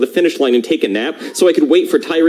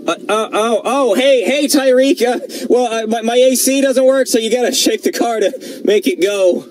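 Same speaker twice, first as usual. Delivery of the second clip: flustered; 8 semitones higher than usual. Acted. Caught shaking car, nervous excuses.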